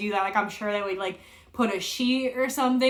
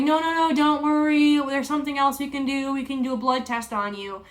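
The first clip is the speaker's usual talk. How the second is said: higher, imitating a nurse soothing a patient; reassuring nurse; 5 semitones higher than usual. Nurse calming a crying patient before surgery, higher reassuring voice. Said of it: "tries comforting me".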